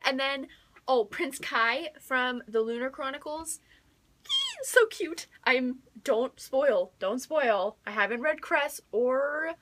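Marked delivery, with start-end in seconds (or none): 4.6-5.3 squealed high and loud. Real, not acted. Fan squealing about Prince Kai, high and excited.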